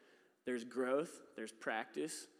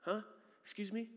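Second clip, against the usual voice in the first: confused surprise; 7 semitones higher than usual. Retold or imitated. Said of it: "was like".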